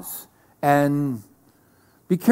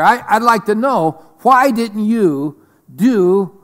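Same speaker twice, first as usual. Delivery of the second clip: higher and louder, agitated stammering questions; complaining Elijah; 6 semitones higher, 5 dB louder than usual. Acted. Preacher voicing Elijah complaining to God, agitated. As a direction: fire off questions like an upset prophet.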